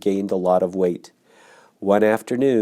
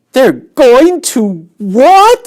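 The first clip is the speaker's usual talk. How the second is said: high, slow, loud shocked scream; piglet's horrified squeal; 12 dB louder, much slower than usual. Acted. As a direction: shriek it in horror as a pig. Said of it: "screamed Wilbur".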